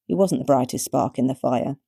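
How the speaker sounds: plain reading voice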